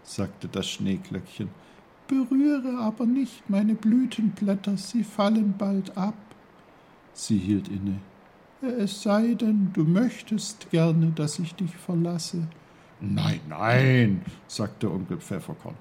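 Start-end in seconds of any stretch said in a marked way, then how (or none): 2.1-6.2 a tiny snowdrop speaking gently. Acted. The snowdrop answers Uncle Pfefferkorn in a high little voice. 8.6-12.5 a tiny snowdrop speaking gently. Acted. The snowdrop answers Uncle Pfefferkorn in a high little voice. 13.0-14.2 a hint of an old uncle, a bit louder; lightly voiced old uncle. Uncle Pfefferkorn reassures the snowdrop, lightly voiced.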